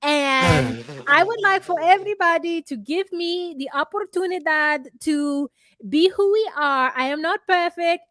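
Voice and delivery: speech with a foreign lilt, stiffly dignified; Jasmine impression. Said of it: "she's like".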